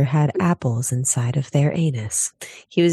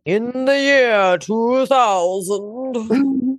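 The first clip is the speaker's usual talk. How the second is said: trailer announcer; 7 semitones higher, much slower than usual. Pretend. Dramatic movie-trailer voice.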